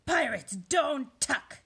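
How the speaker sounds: slow, high, loud refusal; bellowing. A narrator's reading. Captain refuses the boy, slow bellow. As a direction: bellow each word slowly. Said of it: "he bellowed".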